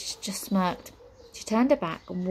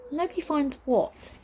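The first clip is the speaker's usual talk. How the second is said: put-on girl's question; 7 semitones higher than usual. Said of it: "asked Nine".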